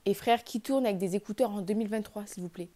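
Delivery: normal talking voice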